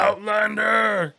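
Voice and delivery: loud, high, slow monster bellow; Cookie Monster voice. Retold or imitated. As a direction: drag out the monster cry. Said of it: "like, Cookie Monster voice".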